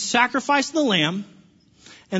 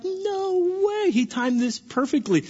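amazed reader; 10 semitones higher, slower than usual. Retold or imitated. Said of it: "you're like".

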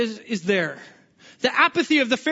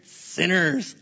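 disdainful sneer; 5 semitones lower than usual. Retold or imitated. Preacher mocking the Pharisees, scornful low sneer. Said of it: "what's that word?".

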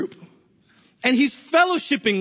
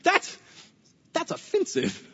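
laughing, higher mock offence; offended Levi; 6 semitones higher than usual. Levi reacting to Jesus at his party, mock offended laugh. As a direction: act stung but amused. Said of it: "you gotta be sitting here going".

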